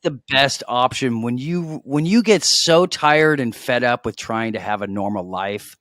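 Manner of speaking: loud, high sales pitch; infomercial pitch. Pretend. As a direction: sell it like a TV ad. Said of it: "Sounds like an infomercial".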